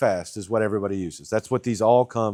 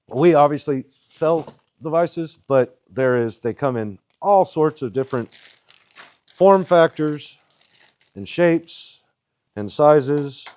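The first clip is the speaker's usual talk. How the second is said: talking around a cigarette; words round a cigarette in the lips; cigarette in mouth; 6 semitones higher, 5 dB louder, slower than usual. Real, not acted. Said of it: "I'm getting recorded with a c-, cigarette in my mouth".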